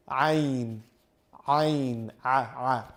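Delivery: ain squeezed from the middle of the throat, tonsil moving; ain demo. Shown as a demonstration. Teacher tells viewers to watch, then squeezes out the ain.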